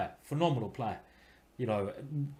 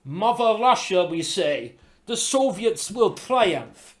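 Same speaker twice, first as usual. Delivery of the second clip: loud, high mock-Russian accent; badly faked Soviet Russian accent; 5 semitones higher, 6 dB louder, slower than usual. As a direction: proclaim it in a hammed-up Russian accent. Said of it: "my bad, uh, accent there".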